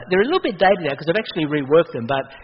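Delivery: normal talking voice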